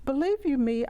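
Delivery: ordinary talk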